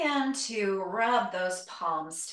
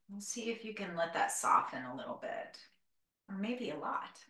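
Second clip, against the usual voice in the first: guided meditation; 2 semitones lower than usual. Acted. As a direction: ease them into stillness, gently.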